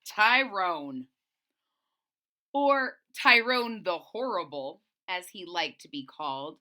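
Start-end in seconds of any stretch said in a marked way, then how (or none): 0.0-4.8 loud, booming villain introduction; dramatic reveal. A narrator's reading. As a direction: announce the bully like a big reveal.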